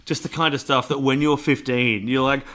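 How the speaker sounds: ordinary delivery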